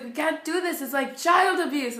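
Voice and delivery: slowing into a loud, dramatized accusation; retold alarmed protest. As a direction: imitate an overwhelmed helper protesting. Acts out the overwhelmed volunteer protesting.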